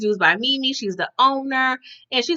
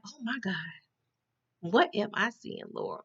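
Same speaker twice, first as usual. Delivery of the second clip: hushed aside; 12 dB quieter than usual. Retold or imitated. Teller stunned by the fight.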